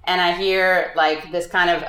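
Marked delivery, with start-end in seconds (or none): none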